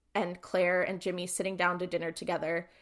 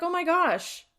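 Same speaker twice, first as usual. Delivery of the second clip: high-pitched imitated surprise; retold happy shock, voice lifted; mock astonishment; 9 semitones higher, 3 dB louder than usual. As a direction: act out a startled greeting, pitched up. Acting out friend's astonished greeting.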